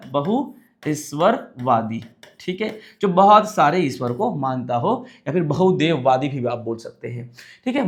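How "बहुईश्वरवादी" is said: slow repeat. Shown as a demonstration. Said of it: "क्या बोला?".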